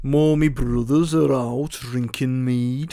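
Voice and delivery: slow, drawn-out mock Brummie; Tommy Shelby. Gangster answering Pricey about his brothers, slow mock Brummie. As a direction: drag out every word in a bad Birmingham accent. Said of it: "I will be Tommy Shelby".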